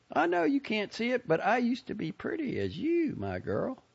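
put-on high, gushing old lady voice; a proud, fussy Southern lady; chatty old lady. A narrator's reading. Lady character voice.

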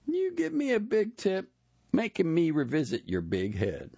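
high, playful teasing voice; teasing barber. A narrator's reading. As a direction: tease him about his head. Tiny teasing Jerome.

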